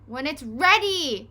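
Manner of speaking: loud high yell; screamed. A narrator's reading. Dad screaming back at Henry, loud. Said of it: "screamed Dad".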